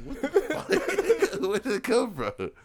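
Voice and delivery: high, loud cry breaking into laughter; squealed, laughing mock outrage; laughing disbelief. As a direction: act out bafflement while cracking up. Retells his own baffled reaction, laughing.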